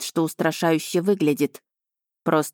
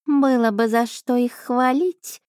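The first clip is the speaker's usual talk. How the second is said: high indignant cat voice; indignant cat familiar; 6 semitones higher, slower than usual. A narrator's reading. Cat familiar grumbling at princess, high indignant voice. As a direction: huff like an affronted cat. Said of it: "возмутилась Аша".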